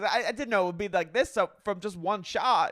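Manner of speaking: high, loud mock whine; Brittany. Wife excuses her sickness to husband, whining. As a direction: plead innocence in a hammed-up wail. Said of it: "She goes".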